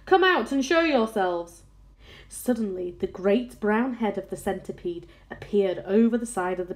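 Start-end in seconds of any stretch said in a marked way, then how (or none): 0.0-1.6 raised, higher-pitched command; shouted order. A narrator's reading. Police chief ordering hidden travellers to appear, shouted command. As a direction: order them out, calling upward. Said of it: "shouted the chief of police".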